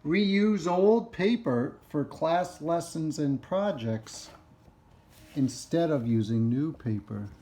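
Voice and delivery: slow, stretched-out key words in a read-aloud; reading to children. Acted. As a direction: read each phrase out deliberately for children. Reads book to children.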